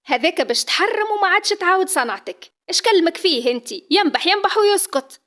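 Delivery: scolding mother. Acted. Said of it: "تقحر لها".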